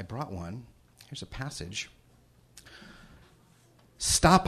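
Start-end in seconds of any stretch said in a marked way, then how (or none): 4.0-4.5 sharp, high, loud cry of stop; screamed. Acted. The narrator screams at the girl sprinting away, loud and high. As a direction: yell it to stop her. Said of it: "I screamed".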